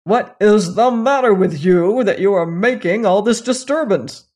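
loud, cross call from a king; crying out. A narrator's reading. King calling from window to howling son, crying out. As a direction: call out sternly in a put-on king's voice. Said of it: "the king heard him and cried out from the window".

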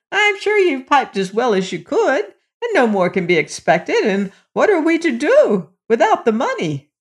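put-on high-pitched, protesting old woman; old mother crying out. A narrator's reading. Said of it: "cried his mother".

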